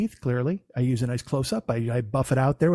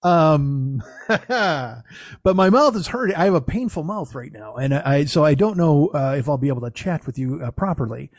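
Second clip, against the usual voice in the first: normal talking voice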